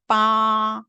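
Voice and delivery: 빠 high, long and level; word reading in tone. Shown as a demonstration. Teacher reading a word for students, level 빠. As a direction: stretch 빠 on one high pitch. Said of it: "제가 한번 읽어볼게요".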